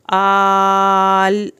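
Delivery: आल with a long, held a; stretched vowel. Shown as a demonstration. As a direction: draw the a of आल out. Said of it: "अलिफ खड़ी ज़बर मद".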